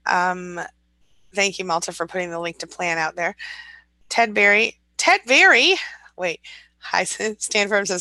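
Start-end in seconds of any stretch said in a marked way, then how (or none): 4.1-5.8 surprised recognition. Real, not acted. Spotting an old viewer in chat.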